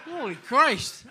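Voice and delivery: high, loud and slow astonished outburst; gasped disbelief at the money; stunned exclamation. Retold or imitated. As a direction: blurt it out, floored by the offer. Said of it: "I went".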